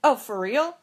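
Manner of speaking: retold sudden change of mind; taken aback. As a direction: react as if genuinely convinced. Sister suddenly believes the denial.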